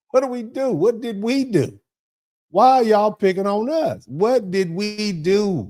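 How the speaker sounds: hurt disbelief, asking over and over; exasperated pleading. Real, not acted. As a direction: throw up your hands and plead to the chat. Pleading why are y'all picking on us.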